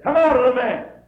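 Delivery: high, booming exorcism order; commanding shout. Retold or imitated. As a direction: cast it out at full volume. Jesus casting out demons.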